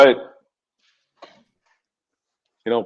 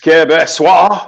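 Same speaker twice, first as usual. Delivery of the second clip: mock French accent; 4 semitones higher, 3 dB louder, slower than usual.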